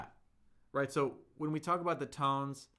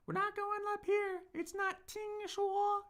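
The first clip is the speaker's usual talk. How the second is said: 听说 squeaked up in falsetto, far above the first tone; mock falsetto. Shown as a demonstration. Teacher warning students off falsetto, squeaky wrong demo. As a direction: show the wrong falsetto 听说, way up high. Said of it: "It's not falsetto".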